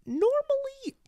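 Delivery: exaggerated Spanish accent, slow and stretched. Shown as a demonstration. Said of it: "that's not how I talk".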